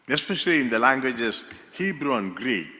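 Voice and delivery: ordinary delivery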